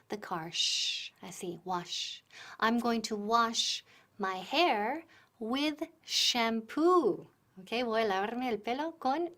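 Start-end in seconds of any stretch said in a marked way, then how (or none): 2.6-7.3 slow, careful, every word clear; slow model sentence. Shown as a demonstration. Teacher modelling a sentence for students to repeat. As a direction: lay it out slowly for repeating. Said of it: "So repeat".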